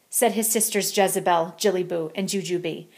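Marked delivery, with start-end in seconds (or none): none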